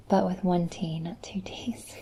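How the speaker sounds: whispering the tone lesson. Real, not acted. Tutor whispering tone rules to viewer.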